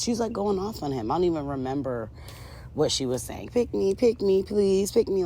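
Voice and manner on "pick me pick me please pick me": high-pitched mocking plea; mock pleading. Woman begging her man to choose her, mock pleading. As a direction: beg in an exaggerated, higher voice.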